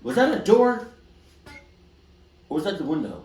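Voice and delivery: loud, high-pitched alarmed question; startled jump up in pitch and volume. Real, not acted. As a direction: blurt it out at a sudden noise. Startled by noise in the voicemail.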